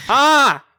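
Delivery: sudden loud scream of dismay; loud yelp. Retold or imitated. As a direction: yell out like you just got caught. Story of getting caught buying games.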